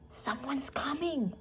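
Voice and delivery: slow, unvoiced warning; urgent whisper. A narrator's reading. Annie warning Jack of approaching footsteps, whispered. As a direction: whisper it so no one hears.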